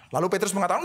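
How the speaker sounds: normal talking voice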